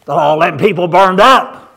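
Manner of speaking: loud, ringing preacher's cry; loud pulpit cry; preaching shout. Real, not acted. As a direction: belt out the judgment from the pulpit. Preacher's climax on the burning.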